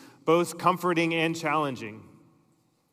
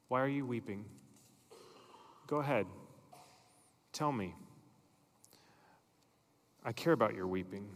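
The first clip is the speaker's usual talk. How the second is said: soft, low, tender asking; tender invitation; 4 semitones lower, 10 dB quieter, faster than usual. Acted. Jesus invites someone weeping to be honest, tender and hushed. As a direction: invite them gently, leaving space after each phrase.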